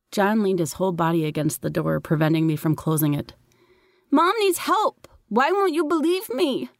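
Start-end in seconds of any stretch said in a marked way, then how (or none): 4.1-6.7 put-on high child's voice, close to tears of frustration; a six-year-old nobody believes; desperate little boy. A narrator's reading. Little brother desperate, not believed.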